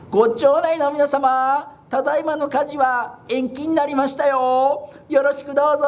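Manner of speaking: mock-official public announcement; town crier announcement. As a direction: announce it to the whole street. Announcing the fire is postponed.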